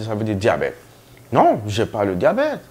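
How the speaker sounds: usual speaking voice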